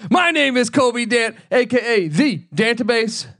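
hyped show host opening; hype host intro. Acted. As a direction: pump up the listeners.

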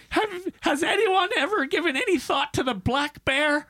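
high, wailing mock sob; high-pitched fake crying plea; mock sobbing. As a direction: ham up a distraught woman near tears. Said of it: "she's already sobbing".

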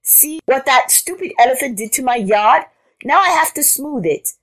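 high-pitched cross rabbit; scolding rabbit. A narrator's reading. Rabbit telling Frog off, high scolding.

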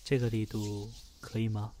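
soft, hushed massage murmur; soothing spa whisper. Acted. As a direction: speak gently like a spa therapist.

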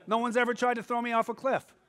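ordinary delivery

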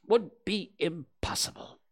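high, measured pronouncement; grave, deliberate declaration; solemn. A narrator's reading. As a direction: pause weightily before the verdict. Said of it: "said Rabbit solemnly".

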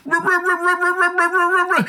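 high, repeated engine sputter mimicking a car that won't start; imitating the lady as a car engine failing to turn over, high sputtering ruh sounds. Mocking a stalling car engine, high sputter. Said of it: "she sounded like a car trying to".

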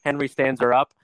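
ordinary talk

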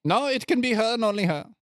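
high, quick doctor voice; insistent doctor. Acted. Doctor refuses the volunteer for his potion, insistent. Plays Doctor Valentine in scene.

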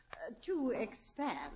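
slow, breathy aside as if it's impolite to say; whispered, tactful suggestion; hushed hint. Acted. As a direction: drop to a near whisper on the delicate bit. Delicate hint about crowding.

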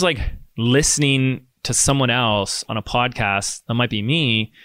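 ordinary delivery

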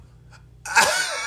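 loud high laugh. Real, not acted. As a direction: crack up loudly. Big laugh.

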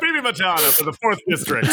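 punchy election ad voice; campaign announcer. Pretend. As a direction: announce the candidate proudly. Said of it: "mock campaign announcement".

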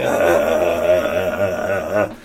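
strained unsettling laugh; a cracked laugh that would scare someone; creepy weird laugh. Shown as a demonstration. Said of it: "my laugh would be like".

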